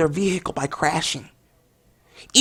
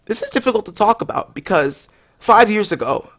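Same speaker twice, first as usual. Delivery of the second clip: usual speaking voice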